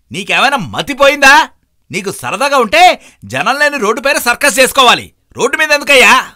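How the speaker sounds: high, loud furious rant; angry scolding. Acted. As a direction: tear into the careless cyclist. Knocked-off biker scolds cyclist.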